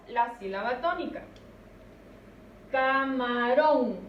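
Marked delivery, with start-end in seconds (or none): 2.7-4.0 camarón slow and loud, stretching the last syllable "rón"; stress on final syllable. Shown as a demonstration. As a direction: draw out camarón, landing firmly on "rón". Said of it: "Escuchemos dónde recae la mayor fuerza". (camarón lento, alargando y acentuando "rón")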